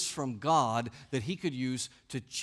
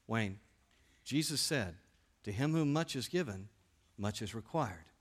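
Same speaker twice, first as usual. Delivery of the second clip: solemn teacher; 5 semitones lower, 5 dB quieter than usual. Retold or imitated. Teacher says something profound.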